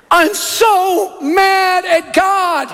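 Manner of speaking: high, drawn-out mock wail; tearful outburst. Grieving woman to stranger in the store, mock tearful wail. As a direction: wail it very slowly, imitating a woman in tears. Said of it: "she burst in tears".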